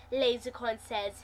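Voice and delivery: ordinary delivery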